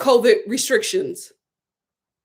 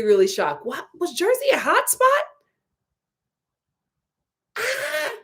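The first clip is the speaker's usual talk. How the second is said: usual speaking voice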